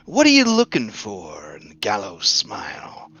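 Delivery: breathy sly whisper; whispered skeleton voice. Acted. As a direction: lean in and whisper it slyly. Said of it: "the skeleton says".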